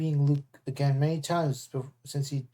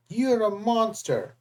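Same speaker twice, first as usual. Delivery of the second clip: accusing shout; 6 semitones higher, slower than usual. A narrator's reading. Annabeth yelling at Luke.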